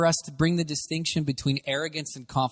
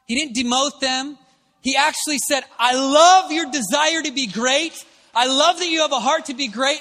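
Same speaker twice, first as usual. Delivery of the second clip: higher, louder preaching; earnest preaching; 8 semitones higher, 4 dB louder than usual. Acted. Preacher telling how Jesus answered the disciples, earnest preaching. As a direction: press the point home.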